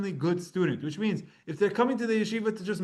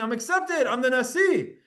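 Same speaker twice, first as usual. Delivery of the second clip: proud claim; 6 semitones higher than usual. Retold or imitated. Rabbi voiced claiming leadership.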